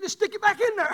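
high-pitched, dismissive imitation; a hint of an impatient daughter; lightly voiced daughter. Daughter telling dad to put the board away, mildly imitated impatience. Voicing daughter in retold squabble.

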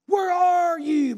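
high, slow, loud call; God calling. Acted. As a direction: draw it out as a call. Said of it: "He was calling".

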